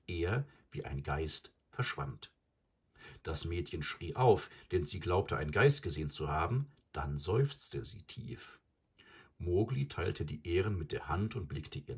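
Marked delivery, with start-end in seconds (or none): none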